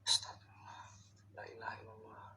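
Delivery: hushed frightened prayer; whispered prayer. Real, not acted. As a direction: pray under your breath. (berdoa sambil berbisik)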